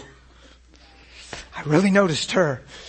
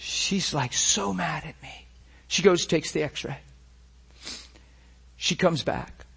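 usual speaking voice

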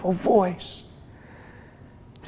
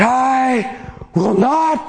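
passionate cry; 8 semitones higher, much slower than usual. Retold or imitated. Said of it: "so passionate, so loud".

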